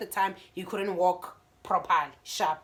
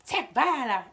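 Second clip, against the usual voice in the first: mild retort; 5 semitones higher, 6 dB louder, slower than usual. Retold or imitated. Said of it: "I'm like".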